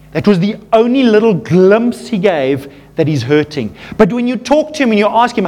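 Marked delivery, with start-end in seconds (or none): none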